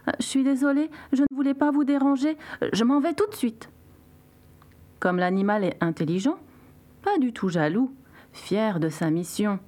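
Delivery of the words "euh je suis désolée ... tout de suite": small, high, hurried beetle voice; timid apology. A narrator's reading. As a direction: apologize meekly in a high voice, eager to get away. Beetle apologizes to angry crocodile. (petite voix aiguë qui s'excuse)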